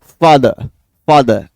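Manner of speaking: father with no R at the end; correct pronunciation of father. Shown as a demonstration. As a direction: model father ending in a vowel. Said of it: "अंत में ए की ध्वनि दे रहा है".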